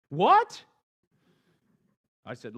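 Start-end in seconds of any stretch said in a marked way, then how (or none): 0.1-0.6 high, loud incredulous yelp; can't believe what he heard; stranded man stunned by God's advice; shocked what. A narrator's reading. Fred's shocked reaction.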